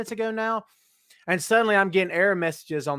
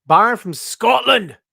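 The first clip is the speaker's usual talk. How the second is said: mock Scottish accent; 4 semitones higher, 8 dB louder than usual. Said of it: "I love doing that".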